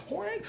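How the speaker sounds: high, soft siren wail; siren imitation. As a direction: mimic a distant air-raid siren. 空襲警報のサイレン. (高く小さなサイレンの真似)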